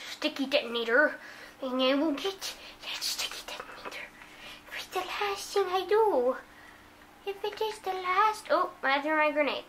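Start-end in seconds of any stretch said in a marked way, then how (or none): 1.6-4.0 hushed gamer whisper. Real, not acted. Kid gaming alone, whispering his target. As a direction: whisper the threat under your breath. Muttering threat over a gaming kill.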